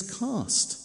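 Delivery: "Cast" lifted high and said firmly; a forceful, lifted "Cast"; stress on cast. Shown as a demonstration. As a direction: land "Cast" as a single strong word. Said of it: "cast is a powerful word".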